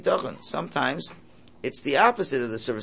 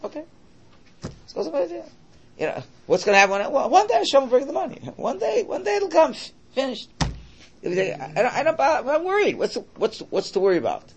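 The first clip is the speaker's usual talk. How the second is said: higher, louder, carefree; mock carefree; 12 semitones higher, 4 dB louder than usual. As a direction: ham up a carefree shrug. Mocking careless attitude.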